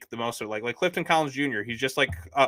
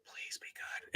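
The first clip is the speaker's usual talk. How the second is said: hushed pleading whisper; 16 dB quieter, slower than usual. Retold or imitated. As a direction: breathe it out like a little prayer. Hoping the new film is good.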